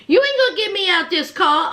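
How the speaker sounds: fast, lifted-voice imitated refusal. Sister's refusal repeated, raised.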